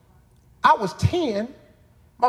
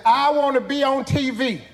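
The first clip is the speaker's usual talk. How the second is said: put-on higher and louder, proud kid; proud eager answer; 9 semitones higher, 4 dB louder than usual. Boy telling his teacher his dream, proudly. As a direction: announce your dream with pride.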